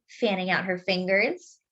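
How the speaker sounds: plain reading voice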